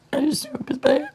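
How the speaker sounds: breaking into a whisper; barely voiced through tears; tearful whisper. Real, not acted.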